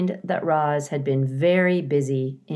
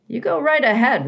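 high, louder beaver encouragement; a beaver cheering Roz on; 9 semitones higher, 6 dB louder than usual. A narrator's reading. Mister Beaver voiced.

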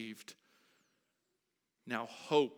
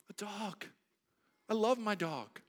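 ordinary talk

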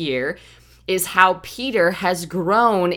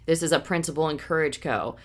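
normal talking voice

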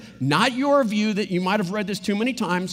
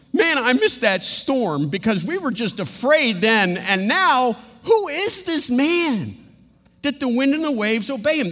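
higher, slowed, awed; awed fear; 5 semitones higher, 4 dB louder than usual. Acted. Sailors to each other, higher in awed fear. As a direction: gasp it out in awe. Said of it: "the guys are going".

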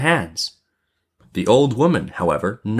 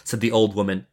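ordinary delivery, nothing marked

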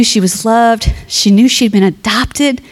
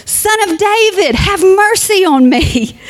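higher cry of a beggar calling out; a blind beggar crying out to Jesus over the crowd, higher; louder shout; 10 semitones higher, slower than usual. A narrator's reading. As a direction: cry out again, higher and pleading. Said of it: "he only shouted louder".

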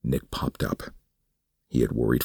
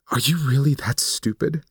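much higher, contemptuous woman's voice; scornful woman; 11 semitones higher, 4 dB louder than usual. Acted. Suzanne mocks Nick for trusting her: scornful, higher. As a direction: sneer down at him.

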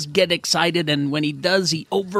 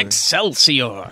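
slow, lower grand proclamation; Stan Lee catchphrase; 4 semitones lower, much slower than usual. Shown as a demonstration. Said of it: "now Gottfried's saying Excelsior".

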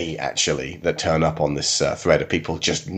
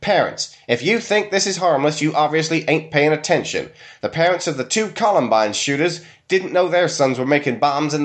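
put-on much higher, preachy Southern accent, fast in places; 9 semitones higher than usual. Host mocking a religious forum post, high and Southern. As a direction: read it as an alarmed Southern church mom. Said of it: "I'm gonna have to do my southern accent".

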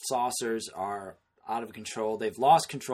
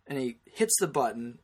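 usual speaking voice

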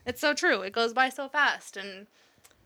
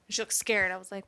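usual speaking voice

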